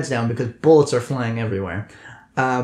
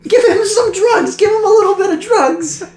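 high squeaky voice; 7 dB louder than usual. Acted. Commissar voice, laughing.

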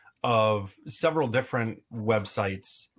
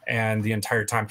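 usual speaking voice

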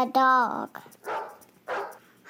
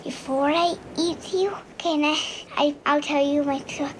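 ordinary delivery

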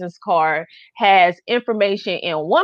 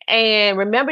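ordinary delivery

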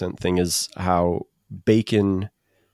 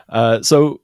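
ordinary talk, nothing marked

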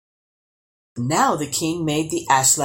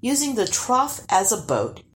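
usual speaking voice

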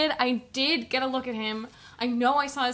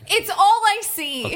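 louder and higher, a panicky pleading burst; flustered outburst; 8 semitones higher, 8 dB louder than usual. Pretend. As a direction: blurt it out, overwhelmed. Flustered witness in improv scene.

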